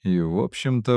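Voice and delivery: plain reading voice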